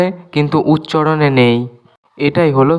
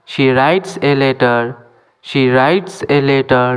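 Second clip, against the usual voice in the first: slow, clear English for learners; careful dictation pace; slow teaching demo; much slower than usual. Shown as a demonstration. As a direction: spell each word out slowly for students. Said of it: "এখানেও W silent".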